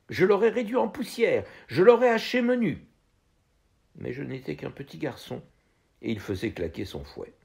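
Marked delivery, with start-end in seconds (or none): 0.1-2.9 put-on boyish fury. Garçon rêve de se venger.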